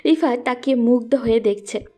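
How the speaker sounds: plain reading voice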